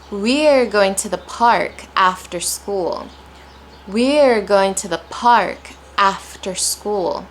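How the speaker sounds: slow, with "We're", "park" and "after" each stressed; stressed key words. Shown as a demonstration. Teacher modelling where the stress goes, slow and emphatic. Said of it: "I'm placing stress on after".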